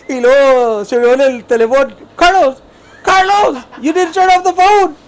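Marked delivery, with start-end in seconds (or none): 2.1-4.9 high, loud call down the phone; imitated boss's shout. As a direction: holler it like the boss who overheard, names drawn out. Boss overheard everything, calls out.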